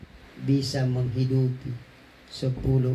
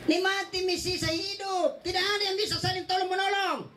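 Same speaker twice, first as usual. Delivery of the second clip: high, laughing sermon voice; fiery preaching. Acted. Preacher raises voice, full force. (ceramah berapi-api)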